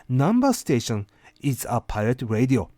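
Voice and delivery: normal talking voice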